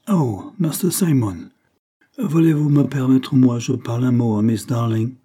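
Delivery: lightly hammed English accent in French, like a circus act; a hint of an English lady mangling French; English-French jargon. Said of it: "dans un baragouin franco-anglais, comme au cirque".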